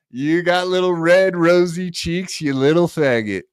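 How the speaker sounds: ordinary talk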